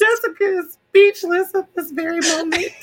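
high laughing words; talking through laughter. Real, not acted. Laughing over classmate's grandbaby.

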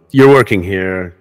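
ordinary talk, nothing marked

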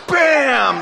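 high, sudden BAM; punchy sound-effect exclamation; explosive imitated crash. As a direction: burst it out like a chair collapsing. Imitates chair breaking.

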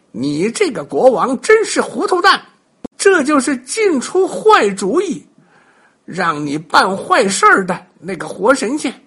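Monkey tells the muddled king off; scolding. A narrator's reading. 孙悟空数落国王. (孙悟空高声数落糊涂国王)

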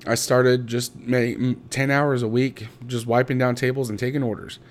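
ordinary talk

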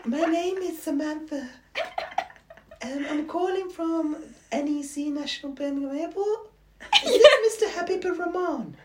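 put-on high, polite girl's phone voice. Prank calling his dad, high fake girl's voice. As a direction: pitch way up and play a sweet young woman on the phone. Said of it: "tried to make it a girl's voice".

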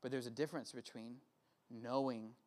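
soft, hushed prayer-time voice; quiet altar call. Acted. Pastor guiding students with bowed heads, soft and hushed. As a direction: gently guide bowed heads into listening.

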